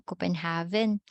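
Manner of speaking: Copenhagen with v for g, Copen-HAH-ven; spelling pronunciation. Shown as a demonstration. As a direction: sound a clear v in Copenhagen. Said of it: "may differences na ng letters".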